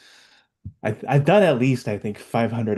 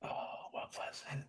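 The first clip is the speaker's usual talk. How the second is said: whispering; 21 dB quieter than usual. Real, not acted. Muttering to himself, recalling.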